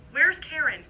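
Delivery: panicked mother, loud; alarmed shout. Acted. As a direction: call out in sudden fear for her child. Mother panics about missing daughter.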